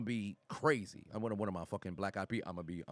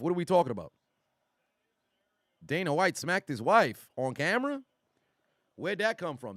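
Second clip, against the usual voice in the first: ordinary delivery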